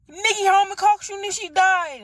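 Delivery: high, loud wailing cry; godmom wailing news. Retold or imitated. Godmom breaking the news, high and loud. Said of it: "She's like".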